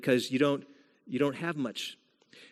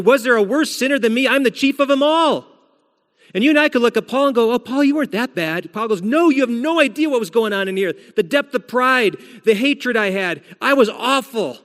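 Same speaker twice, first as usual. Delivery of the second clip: raised, high, fervent voice as Paul crying out his guilt; impassioned confession; 8 semitones higher, 5 dB louder than usual. Retold or imitated. A pastor voicing Paul's loud, high anguished confession.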